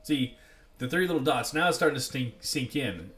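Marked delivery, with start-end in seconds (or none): none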